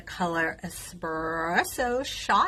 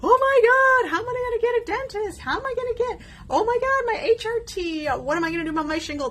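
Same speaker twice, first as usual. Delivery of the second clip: high, frantic, rushed panic; lying awake at night, panicking over dentist and bills, high frantic voice; panicked; 5 dB louder, faster than usual. Retold or imitated. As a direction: replay the midnight panic at top pitch. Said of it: "like, freaking out".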